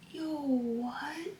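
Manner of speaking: breathless whispered disbelief; hushed, stunned reaction; startled whisper. Real, not acted.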